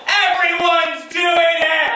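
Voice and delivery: desperate drawn-out shout; yell. Retold or imitated. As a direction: justify yourself in panic. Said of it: "And I just yell".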